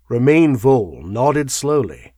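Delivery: ordinary delivery, nothing marked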